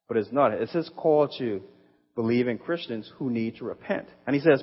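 usual speaking voice

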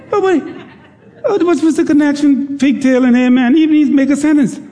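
high, laughing "what's the connection?"; amused high-pitched disbelief. Real, not acted. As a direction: crack up at the absurd amen. Laughing at Gator's amen.

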